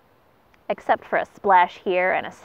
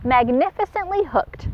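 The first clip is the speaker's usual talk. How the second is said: mild shout; 7 semitones higher than usual. Acted. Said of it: "shouted the pelican".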